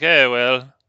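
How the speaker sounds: smug British. Retold or imitated. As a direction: sneer in a British accent at the young chap. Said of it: "kind of a smug British".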